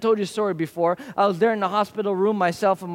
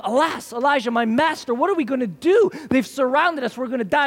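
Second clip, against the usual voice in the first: a frightened servant crying out to his master; panicked servant; 5 semitones higher than usual. Retold or imitated. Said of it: "And his servant said to him".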